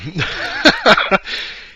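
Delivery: a high breathless giggle; high giddy laugh. Real, not acted. Remembering the Green Goblin figure, a high giddy laugh. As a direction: giggle at how excited you are. Laughing at his own excitement.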